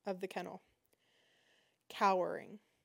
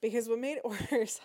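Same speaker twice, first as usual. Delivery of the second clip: ordinary delivery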